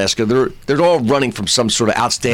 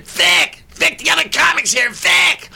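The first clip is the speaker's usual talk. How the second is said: high, shrieked retold call; mock scream; slower than usual. Woman alerting Vic the other comic's here, mock scream. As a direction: scream it out very high, at contained volume. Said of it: "She just starts screaming".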